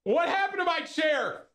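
fighting the chair and losing patience; real frustration. Real, not acted. Streamer struggling with his broken chair, real high exasperation.

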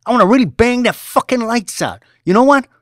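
a boss in a temper, loud and high; angry boss. Acted. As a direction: rage at the underling. Boss raging at employee.

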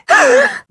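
high, sudden intake of breath; sharp gasp. Acted. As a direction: gasp as if startled. Startled gasp in game.

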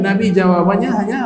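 normal talking voice

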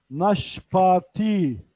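Naşpati drawn out very slowly, each syllable clear; slow model word. Shown as a demonstration. Teacher to online Urdu class: Naşpati drawn out very slowly. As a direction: stretch Naşpati slowly for learners. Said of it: "teacher models Urdu word slowly".

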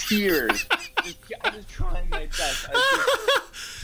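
laughing through the words; laughing hard. Real, not acted. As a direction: crack up while telling it. Laughing to tears recalling.

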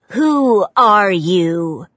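slow, drawn-out hiss of a suspicious goose. A narrator's reading. Goose hissing at the newcomer, slow and suspicious. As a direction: hiss it at the stranger, slow and wary. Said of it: "Gordy hissed at him".

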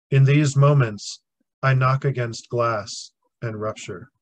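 measured poem recital, short phrases set apart by long pauses; poetry reading. Acted. Survivor shares own poem Waking with listeners, solemn poetry reading. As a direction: deliver each line firmly, then let it hang.

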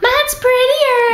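high, loud, drawn-out taunt; sing-song one-upping, stretched and high; mock squabbling. Childish mock squabble over necklaces.

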